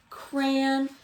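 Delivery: ordinary delivery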